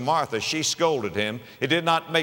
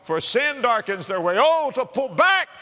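poem recital; 5 semitones higher than usual. Acted. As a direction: chant the verse with swelling fervour.

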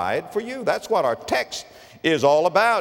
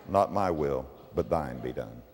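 much lower and softer, grave pronouncement; solemn voice of God; 12 semitones lower, 7 dB quieter than usual. Acted. God answering those who refuse him, low and grave. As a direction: let it fall heavy and final.

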